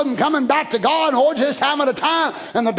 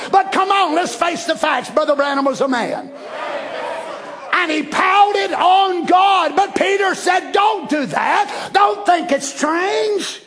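fiery preaching; 7 semitones higher, slower than usual. Acted. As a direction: preach it with full fire. Preaching at full force.

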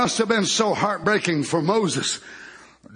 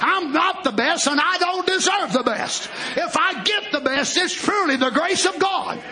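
full-force preaching; 8 semitones higher than usual. Real, not acted. Telling the congregation of a new generation, full-force preaching. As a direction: preach it out with hard, rolling emphasis.

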